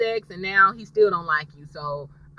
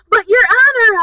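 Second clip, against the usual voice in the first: high whiny objection, much higher; whiny protest; 13 semitones higher, 7 dB louder than usual. Retold or imitated. Lawyer whining at the judge, high and whiny. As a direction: whine it up high like a pleading lawyer.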